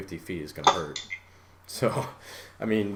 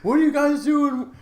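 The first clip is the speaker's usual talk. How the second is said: much higher incredulous question; a high, mocking question from the try-hard kid; mock Russian kid; 5 dB louder than usual. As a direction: pitch it up as the confused kid. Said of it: "He's like".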